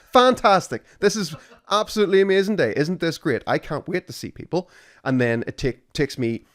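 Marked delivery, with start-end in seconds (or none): 0.1-0.8 Fantastic said much higher and louder, falling; a big fake-cheerful Fantastic, pure sarcasm; hammed-up sarcasm. Sarcastic peak of the rant. 1.0-4.7 higher, louder mock delight, every word dripping sarcasm; over-the-top sarcasm. Sarcastic rant about toothpaste.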